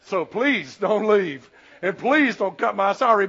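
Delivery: loud, emphatic plea; mock pleading. Pastor begging congregation not to leave, emphatically pleading. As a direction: beg them, leaning hard on each please.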